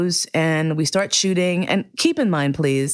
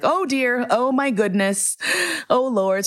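tearful; 8 semitones higher than usual. Retold or imitated. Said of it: "And I started crying".

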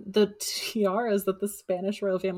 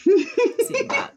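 laughing; 7 dB louder than usual. Real, not acted. Amused at the nutcracker memory.

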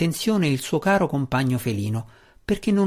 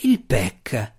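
growled; 2 semitones lower than usual. A narrator's reading. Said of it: "ringhiò Drizzt".

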